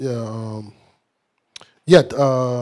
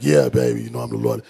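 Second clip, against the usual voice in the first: lazy husband voice. Retold or imitated. Preacher mocking a lazy husband, deep and lazy. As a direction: play the idle man claiming to be lord. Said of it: "lay around in the bed like".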